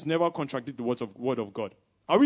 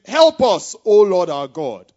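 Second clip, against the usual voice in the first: calling on God; 10 dB louder, slower than usual. Acted. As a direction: cry the prayer out, drawing out the end. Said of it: "Then Asa called to the Lord".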